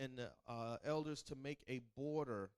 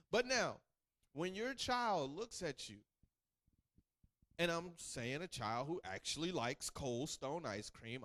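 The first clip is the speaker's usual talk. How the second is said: normal talking voice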